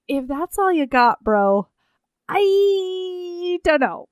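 dragging out the last words very slowly, bro-style; mock bro voice. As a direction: shrug it off like a dude. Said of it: "And I'm like".

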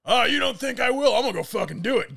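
high, loud, indignant challenge; defiant shout. Retold or imitated. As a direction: call her bluff loudly. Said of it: "old man's defiant reply".

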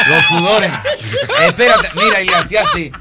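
loud laughing through the words; laughing loudly. Real, not acted. Laughing while retelling the panic. (hablando entre carcajadas)

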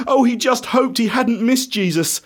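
plain reading voice

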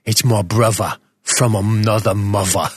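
lightly put-on London accent; mock London accent. Podcaster claims his co-host as brother, in mock London accent. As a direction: play at a Cockney lad. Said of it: "my Jason Statham sort of London thing".

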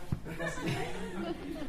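a real, bright burst of laughter; amused laugh. Real, not acted. Host spotting a friend at lunch, laughing. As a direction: laugh out at a familiar face.

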